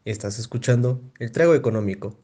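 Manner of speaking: deep radio announcer; smooth slogan read. Acted. Show intro tagline.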